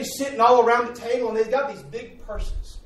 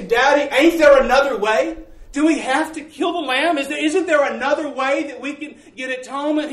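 louder, higher pleading cry; pleading children; 6 semitones higher, 6 dB louder than usual. Retold or imitated. Children beg their father to spare the lamb, loud pleading. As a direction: beg Daddy for another way, loud. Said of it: "And they say".

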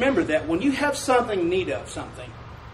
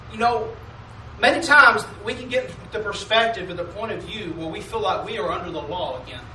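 ordinary talk, nothing marked

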